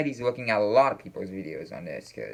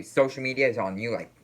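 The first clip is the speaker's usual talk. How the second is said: usual speaking voice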